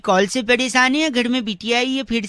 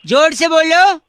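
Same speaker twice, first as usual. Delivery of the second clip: calling out; 5 semitones higher, 5 dB louder than usual. Acted. Prank caller demanding louder.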